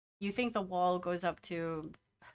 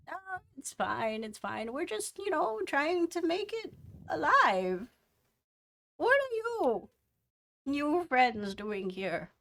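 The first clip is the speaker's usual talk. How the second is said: put-on old woman voice; 7 semitones higher than usual. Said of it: "the old woman".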